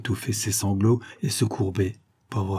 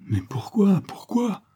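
high, pleading bewilderment; distraught; 11 semitones higher, slower than usual. Acted. Lover is told he cannot see Stella, distraught. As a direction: voice climbing into a high, thin plea. Harry protests being parted from Stella.

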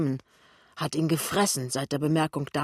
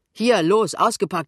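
raised, forceful order; loud command; 4 semitones higher, 8 dB louder than usual. Acted. Heilbutt ordering work to resume, loud and commanding. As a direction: call it out to get things moving.

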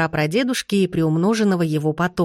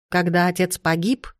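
ordinary delivery, nothing marked

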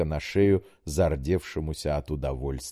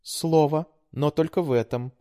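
put-on high, pleading young woman; daughter voice; 10 semitones higher than usual. A narrator's reading. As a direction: coax like a sweet daughter. Daughter voice held.

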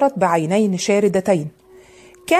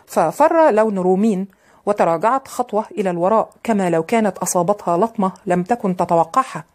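ordinary delivery

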